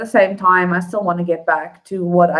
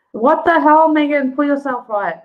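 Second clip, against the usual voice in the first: higher, louder mock scolding at herself; self-scolding outburst; 9 semitones higher, 3 dB louder than usual. Real, not acted. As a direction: snap out of it, half laughing. Scolds herself for getting emotional.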